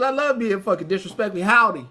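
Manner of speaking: usual speaking voice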